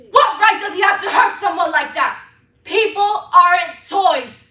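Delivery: loud, rising furious yell; furious shout. Acted. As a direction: hurl it at the world in rage. Furious outburst.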